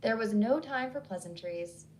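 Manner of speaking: ordinary delivery, nothing marked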